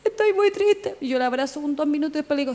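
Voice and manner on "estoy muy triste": imitating sad sister. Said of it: "Mi hermana sabe".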